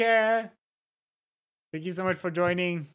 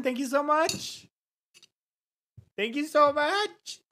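high gushing thanks; 8 semitones higher than usual. Real, not acted.

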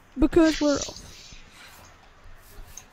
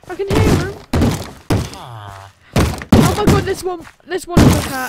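loud and thrilled; excited shout; 3 semitones higher, 8 dB louder than usual. Real, not acted. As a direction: call it out excitedly. Hunting mobs, excited.

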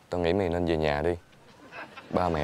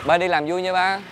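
higher, louder cheery send-off; called-out goodbye; 10 semitones higher, 6 dB louder than usual. Acted. Son to departing father, called-out goodbye. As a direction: call it after someone walking away.

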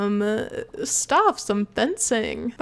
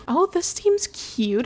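cooing; 7 semitones higher, slower than usual. Real, not acted. Cooing at cute item.

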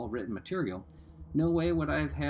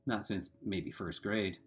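ordinary delivery, nothing marked

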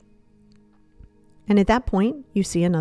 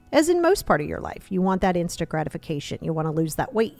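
usual speaking voice

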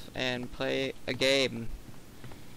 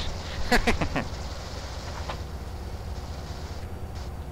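breathy laugh; 4 semitones higher than usual. Real, not acted. Laughs at game.

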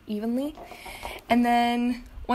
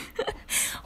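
quiet airy giggle; soft breathy laugh; 6 dB quieter than usual. Real, not acted. Laughing at her forgetfulness.

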